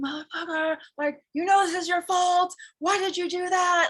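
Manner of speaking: high, loud cursing rant; cursing out the driver. Retold or imitated. Cursing at the driver who cut her off, angry yell. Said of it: "I started cursing".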